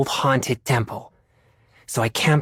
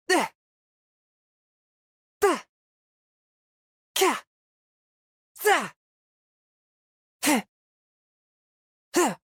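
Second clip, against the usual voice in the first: sharp high effort grunts on each strike; short bursts of exertion, attacking; fighter's clipped effort noises between blows; combat grunts; 13 semitones higher, 4 dB quieter than usual. Acted.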